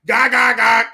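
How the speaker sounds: loud high cackle heard in the night; jungle cackle. Pretend. Scaring listeners with a loud jungle cackle. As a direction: cackle like a creature in the dark jungle. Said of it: "if you heard".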